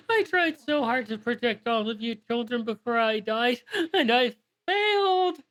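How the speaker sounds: high tearful plea breaking into a wail on failed; bawling dragon. Acted. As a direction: bawl it, rising to a howl at the end. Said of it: "bawling uncontrollably".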